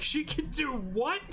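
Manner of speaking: high, drawn-out amazed reaction; mock amazement, voice high; acted astonishment.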